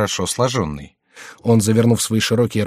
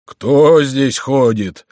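drawn-out, louder, higher cry; startled sleeping watchman; 4 semitones higher, 6 dB louder, much slower than usual. A narrator's reading. Prior woken by a noise calls out like a sleepy watchman. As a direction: call out slowly like a watchman jolted from sleep. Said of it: "закричал он дрожащим голосом заснувшего сторожа".

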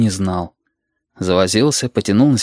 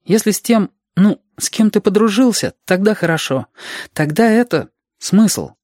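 put-on high, searching child voice; earnest Reshka; 10 semitones higher than usual. Reshka telling Alexander companionship gives meaning, high child voice. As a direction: explain haltingly in a child's high pitch.

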